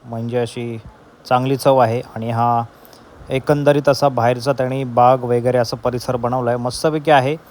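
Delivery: loud, upbeat host delivery; vlog presenter voice. Acted. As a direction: pitch it to camera like a host. Presents breakfast and pool to camera.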